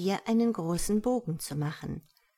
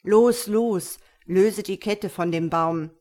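louder, urgent voice in pain; full of pain; 7 dB louder than usual. Acted. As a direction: push it out through the pain. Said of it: "erwiderte die Stimme voll Schmerz". (laut und dringlich, voller Schmerz)

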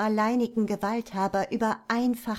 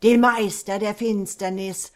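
undead king; 8 dB louder than usual. Acted. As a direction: command them to kneel. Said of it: "schrie er".

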